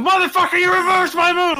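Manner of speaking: mock yell. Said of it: "hollering, hooting, screaming".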